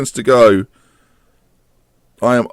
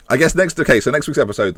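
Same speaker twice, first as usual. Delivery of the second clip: normal talking voice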